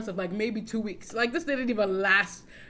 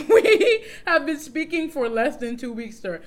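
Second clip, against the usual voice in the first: slow, louder, laughing put-down; laughing mock retort; 3 semitones higher, 6 dB louder, slower than usual. Answering the angry texter, laughing slow put-down. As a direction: scold him with a laugh.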